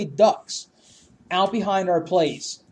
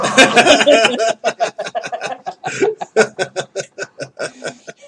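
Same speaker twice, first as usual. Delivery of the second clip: loud laugh; 3 semitones higher, 8 dB louder than usual. Real, not acted. After mock scream.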